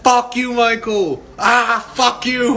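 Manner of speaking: high-pitched imitation of a cursing fit; hammed-up exasperated yell; mock angry shouting. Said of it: "like you're mad at somebody".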